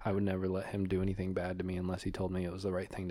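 hushed, low coaching; feed someone lines under your breath; very soft, low murmur; whispering off mic. Real, not acted. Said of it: "I'm whispering".

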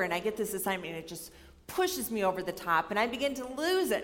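ordinary talk, nothing marked

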